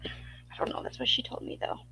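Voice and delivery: whisper. Real, not acted. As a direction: drop to a whisper mid-chat. Aside muttered under her breath.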